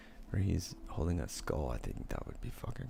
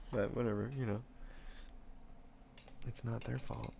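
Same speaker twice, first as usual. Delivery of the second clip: usual speaking voice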